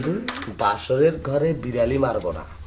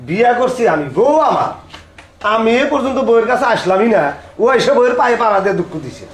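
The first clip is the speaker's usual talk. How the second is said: loud, raised-pitch complaint; indignant scolding; 6 semitones higher, 3 dB louder than usual. Acted. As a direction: protest loudly like a wronged husband. Husband scolds grandson over his bride. (রেগে চড়া গলায় বকাবকি)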